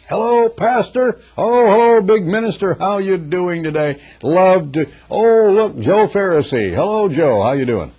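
high, loud gushing mock greeting to a minister; fawning greeter. Churchgoer mockingly fawning over the minister. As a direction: gush over the big minister, hammed-up.

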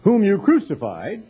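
ordinary delivery

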